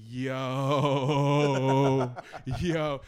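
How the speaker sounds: loud, stretched-out gleeful callout; hammed-up delighted disbelief; mock amazed outburst. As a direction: call it out slowly, breaking into laughter. Retold gleeful discovery of film prank.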